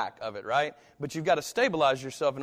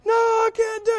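very high, loud panicked refusal; a scared kid refusing, near tears; child refusing to let dad see the thumb; terrified refusal; 11 dB louder than usual. Retold or imitated.

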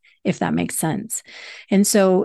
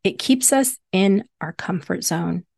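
ordinary talk

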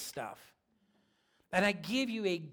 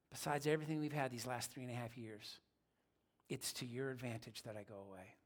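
tender reassurance; 8 semitones lower, 13 dB quieter than usual. Acted. Jesus gently telling his disciples.

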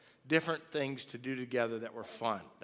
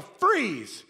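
raised, loud cop shout; yelled cop command; 8 semitones higher, 8 dB louder than usual. Acted. Kid cop at the family on the bed, yelled. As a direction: yell it like a cop bursting in. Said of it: "and yell".

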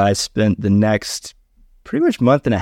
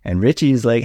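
normal talking voice

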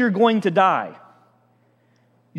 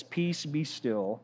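slow, much softer "Peace, be still"; quiet calm command; 3 semitones lower, 12 dB quieter, much slower than usual. Retold or imitated. Jesus rising in the storm, quiet and unhurried. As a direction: say it low-key and unhurried, total authority. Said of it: "stands up and says".

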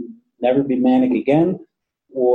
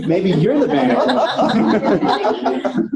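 slow, laughing the words out; laughing tease; 6 semitones higher, much slower than usual. Real, not acted. Teasing a colleague about his manic calls, laughing slowly. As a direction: tease him while laughing, much higher.

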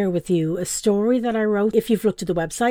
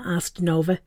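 ordinary delivery, nothing marked

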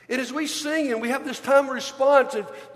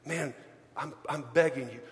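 mock whispered dismay; 6 semitones lower, 9 dB quieter than usual. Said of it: "you're going".

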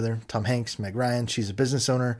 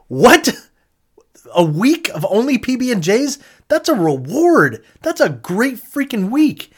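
much higher and louder, slow emphatic disbelief; incredulous excitement; 11 semitones higher, 9 dB louder, slower than usual. Retold or imitated. Retelling his reaction to the have-not twist, loud high incredulity. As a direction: shout your disbelief that it's a punishment at all. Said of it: "And I was like".

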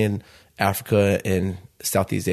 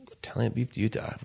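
disbelieving whisper of the idea; 8 dB quieter than usual. Retold or imitated. Repeating the brother's pitch back in a stunned whisper. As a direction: breathe it out, hardly believing it.

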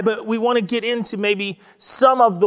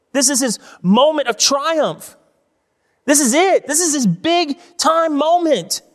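building excitement; 5 semitones higher than usual. Acted. Preacher telling the congregation this is Jesus' moment, rising and excited. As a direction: climb with each line until it rings.

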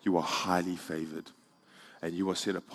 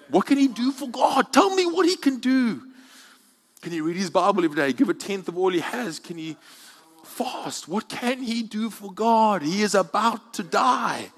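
high, loud, insistent preacher questions; preaching challenge; 13 semitones higher, 8 dB louder than usual. Acted. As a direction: press the crowd for an answer.

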